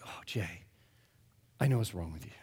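low, hushed knowing reply; grave friend. Retold or imitated. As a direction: drop low, as if breaking bad news. Friend's grave answer after his outburst.

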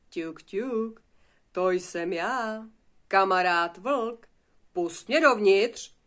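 coaxing wolf. Acted. Wolf at Ervín's straw house asking to come in, slow coaxing. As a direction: call slowly at the door to be let in.

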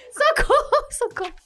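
high-pitched, laughing distress call; cry for help. Retold or imitated. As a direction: re-enact a panicked plea, dropping off on the repeat. Calling for help from shore.

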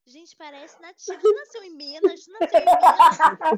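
gushing praise breaking into laughter; mock amazement. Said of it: "a gente fica".

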